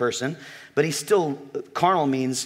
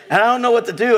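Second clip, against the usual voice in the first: retold panic; 7 semitones higher, 6 dB louder, faster than usual.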